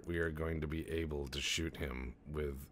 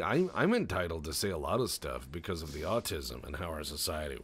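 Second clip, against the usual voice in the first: usual speaking voice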